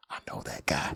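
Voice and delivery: hushed, voiceless alien voice; whispered impression. Alien recognizing a guy from YouTube shorts, whispered impression. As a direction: whisper it as a put-on character.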